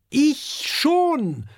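high, proud little voice; proudly. A narrator's reading. Oskar proudly tells his parents he can swim, high and chirpy. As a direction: say it with pride. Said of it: "sagt Oskar stolz".

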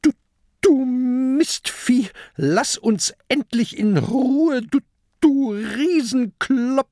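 high, loud, stammering pirate rage; little pirate yelling furiously; furious shouting. A narrator's reading. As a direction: hurl insults in a high, angry shout. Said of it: "schreit der kleine Pirat wütend".

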